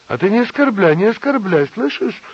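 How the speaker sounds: high, loud indignant yell; angry shout. A narrator's reading. Nina yelling back at Asya's insult. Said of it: "кричала Нина".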